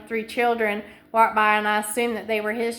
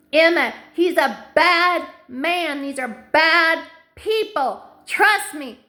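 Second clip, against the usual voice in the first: higher, louder desperate warning to her daughter; mother calling out to her daughter Emma, higher and louder with rising urgency; urgent plea; 6 semitones higher, 3 dB louder than usual. Real, not acted. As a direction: beg her to get away, voice climbing. Pleading directly with her daughter.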